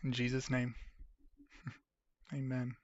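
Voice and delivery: quiet, sincere prayer; soft prayer. Real, not acted. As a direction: pray softly for her. Prays for viewer Kim.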